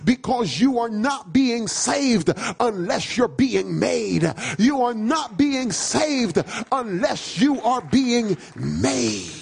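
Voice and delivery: driving, emphatic sermon delivery; full-force preaching. Real, not acted. Preacher telling the church what the Bible says, full-force preaching. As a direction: hammer each phrase home to the congregation.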